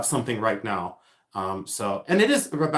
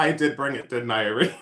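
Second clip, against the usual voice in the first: ordinary talk, nothing marked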